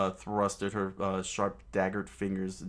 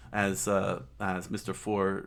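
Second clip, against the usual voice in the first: ordinary delivery, nothing marked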